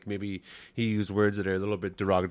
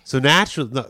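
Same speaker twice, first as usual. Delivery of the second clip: usual speaking voice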